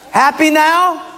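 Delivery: high, loud resentful jab; sarcastic snap. Acted. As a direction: throw it back at her, annoyed. Husband snaps at wife.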